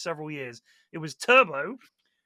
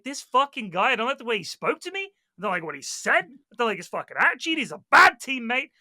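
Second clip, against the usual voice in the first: raised, louder acted complaint; retold angry rant; 5 semitones higher, 6 dB louder than usual. Player complaining to Mars about the sniper, mock outrage. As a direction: rant indignantly about a teammate, hammed up. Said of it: "I was like".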